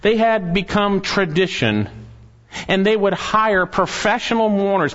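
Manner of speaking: usual speaking voice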